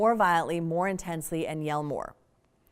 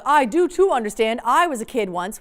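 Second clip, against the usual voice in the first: loud defensive parent; 7 dB louder than usual. Retold or imitated. Parent insisting to teen.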